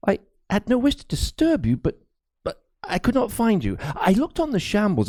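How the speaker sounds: put-on nervous young priest apologizing to Crispin; high, timid priest, anxious and halting; fearful young priest. A narrator's reading.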